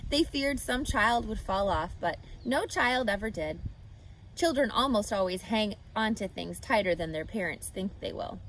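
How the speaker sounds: ordinary delivery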